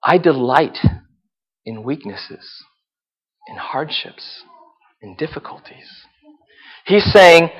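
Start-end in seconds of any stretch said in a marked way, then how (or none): 0.0-6.0 whispered. Shown as a demonstration. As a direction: whisper the list, fading to breath. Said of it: "Did you hear him, what he said".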